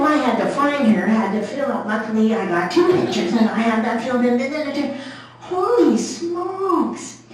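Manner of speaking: ordinary talk, nothing marked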